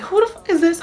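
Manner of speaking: angry challenge. Retold or imitated. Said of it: "And the dude was like".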